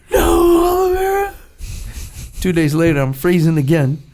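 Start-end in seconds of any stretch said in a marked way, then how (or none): 0.1-1.3 high, wailing, near-sobbing plea; crying plea. Retold or imitated. Freezing trainee sobs his answer to the instructor. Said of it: "And I'm crying".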